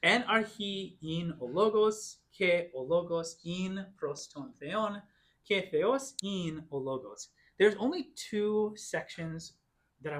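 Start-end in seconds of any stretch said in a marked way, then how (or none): 0.0-7.3 measured, unhurried recitation; slow Greek reading. Shown as a demonstration. Teacher rereading the Greek verse to the class, slowly. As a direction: read the verse slowly, steady and clear. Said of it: "I'll read it again".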